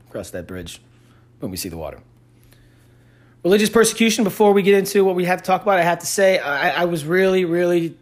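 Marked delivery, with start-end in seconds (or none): 0.1-2.0 softly muttered correction, much softer; quiet correction. Real, not acted. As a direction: mumble the fixed phrase under your breath. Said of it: "did I say that backwards".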